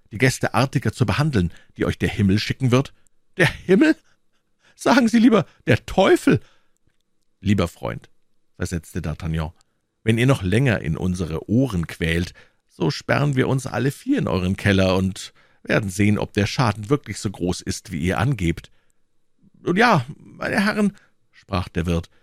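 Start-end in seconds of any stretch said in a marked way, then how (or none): 3.4-6.4 much higher and louder, incredulous; indignant outburst. A narrator's reading. Innkeeper contradicting his guest, high indignant outcry. 19.6-21.0 high-pitched, placating innkeeper; pleading innkeeper. A narrator's reading. Innkeeper to the gentlemen, high and placating.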